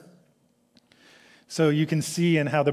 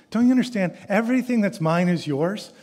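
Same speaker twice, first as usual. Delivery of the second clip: higher, earnest plea; pleading father; 6 semitones higher, 4 dB louder than usual. Acted. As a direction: plead with a stubborn son.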